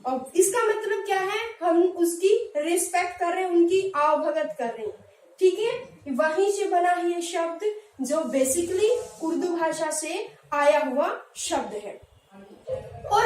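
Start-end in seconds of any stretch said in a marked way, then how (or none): none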